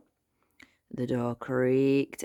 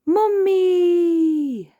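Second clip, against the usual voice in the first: long, very high shout; George's shout; 7 dB louder than usual. A narrator's reading. Boy dressed as a mummy, shouting high. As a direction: shriek it out like a small boy, stretched. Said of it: "shouted George".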